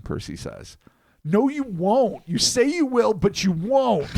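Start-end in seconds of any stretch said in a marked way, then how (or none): 1.2-4.1 high, slow mocking nag. Percy read in mocking voice.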